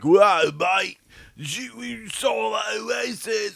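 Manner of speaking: put-on high, loud, slurry English-accented shouting; hammed-up tipsy English fan hollering; mock drunk English accent. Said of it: "we have an English accent".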